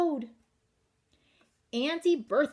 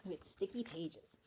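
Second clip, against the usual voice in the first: hushed joking whisper; whispered aside; 3 semitones lower, 14 dB quieter than usual. Acted. Reader whispering a silly joke to the kids.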